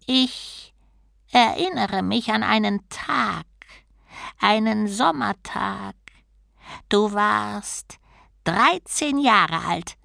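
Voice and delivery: slow, level recital with long pauses; soft monotone drone. A narrator's reading. Turtle recalls Bruta's childhood, quietly droning. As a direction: intone each phrase evenly, as if reciting. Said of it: "Die leise Stimme fuhr eintönig leiernd fort".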